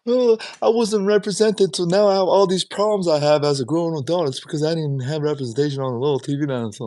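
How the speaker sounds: high, loud whining mock voice; mock a self-pitying whiner; whiny complainer. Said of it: "shut up".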